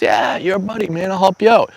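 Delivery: reassuring a frustrated friend with easy kindness; friendly reassurance. Retold or imitated. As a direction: play it cool and generous to a pal. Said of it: "I said".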